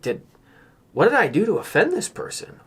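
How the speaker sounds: normal talking voice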